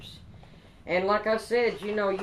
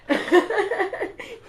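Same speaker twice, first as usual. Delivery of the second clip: loud squealing giggle at herself; high squealing laugh; 12 semitones higher, 6 dB louder than usual. Real, not acted.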